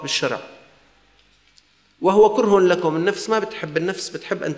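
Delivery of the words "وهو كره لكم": emphatic preaching. Real, not acted. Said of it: "preacher stresses repeated phrase".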